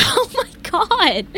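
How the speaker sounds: drawn-out, high-pitched 'oh my God' through laughter; laughing exasperation. Real, not acted. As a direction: laugh in disbelief, stretching it out. Laughs about the endless singing.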